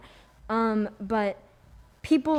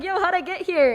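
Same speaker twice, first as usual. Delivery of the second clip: higher, louder bewildered voice; confused cult member; 8 semitones higher, 7 dB louder than usual. Retold or imitated. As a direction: play someone lost and baffled. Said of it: "They're like".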